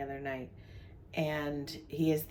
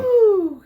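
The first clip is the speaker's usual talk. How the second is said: loud whoop; 14 dB louder than usual. Real, not acted. Laughing at husband's accent.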